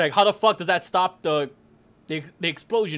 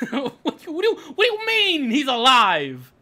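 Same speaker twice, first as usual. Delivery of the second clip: laughing, rising louder; laughing disbelief; 5 semitones higher, 4 dB louder than usual. Real, not acted. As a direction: giggle in disbelief. Laughing incredulous question.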